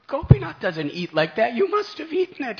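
high, very loud reproach; scolding mother. Acted. Mother scolds her son for eating the prasad, loud and sharp. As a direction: refuse to believe him.

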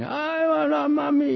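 high, mock wailing children; high crying-child voices. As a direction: imitate a pen full of bawling kids. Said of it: "We were all crying".

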